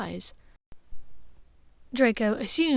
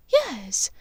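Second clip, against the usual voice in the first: higher mother telling a sad tale; Narcissa, troubled; 4 semitones higher, 4 dB louder than usual. A narrator's reading. Mother telling Draco Regulus vanished, higher. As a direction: voice the worried mother, pitched up.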